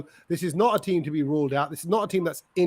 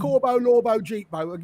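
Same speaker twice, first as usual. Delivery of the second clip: "Corbo, Lorbo, Jeetbo" in a plain English accent; 3 semitones higher than usual. Shown as a demonstration. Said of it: "can't say it in an English accent".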